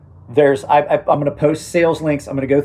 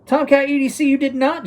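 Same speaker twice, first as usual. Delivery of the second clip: calling out; 12 semitones higher than usual. Real, not acted. Calling out a viewer.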